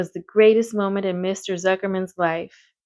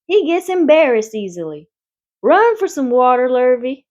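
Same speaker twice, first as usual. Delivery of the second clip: urgent call; 5 semitones higher, 5 dB louder than usual. A narrator's reading. Zuckerman calling urgently.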